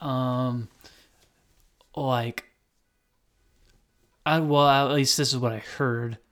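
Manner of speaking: ordinary delivery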